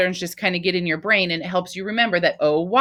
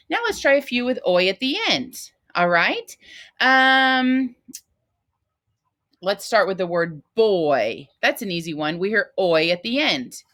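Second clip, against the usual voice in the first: usual speaking voice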